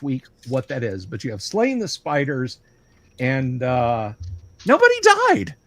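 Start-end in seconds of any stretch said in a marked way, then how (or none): 4.7-5.5 high, loud, cheery announcement; happy voice. Shown as a demonstration. Game master announcing the party survived, happy voice. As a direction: proclaim it brightly up high. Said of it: "that was my happy voice".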